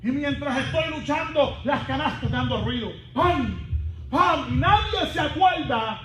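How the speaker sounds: full-force preaching. Real, not acted. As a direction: proclaim it to the whole congregation.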